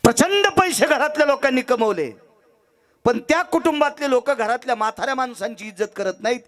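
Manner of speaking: high-pitched moral denunciation; full-force preaching. Acted. Preacher condemning families who neglect their parents, full force. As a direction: drive each charge out high to the crowd.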